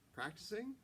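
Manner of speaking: rising question tune on practicing; upspeak. A narrator's reading. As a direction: end Practicing? on a high lift. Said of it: "You're upspeak".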